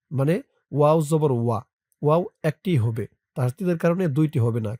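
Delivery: ordinary delivery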